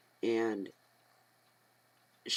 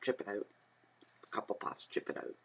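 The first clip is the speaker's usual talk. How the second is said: talking while smoking; 8 dB quieter than usual. Shown as a demonstration. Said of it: "This is how I smoke".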